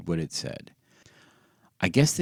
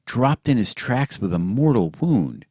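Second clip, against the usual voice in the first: thrilled reading; 5 semitones higher, 6 dB louder than usual. A narrator's reading. Pee-wee reading thrilled.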